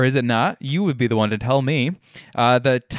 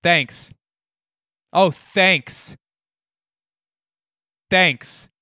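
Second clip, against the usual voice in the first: mock yelling; 5 semitones higher, much slower than usual.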